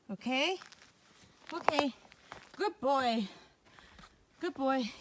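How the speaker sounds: soft, high, coaxing voice; soothing an animal. Pretend. Hiker calming a lizard on the trail, soft and soothing. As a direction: gently talk down a skittish creature, high and hushed.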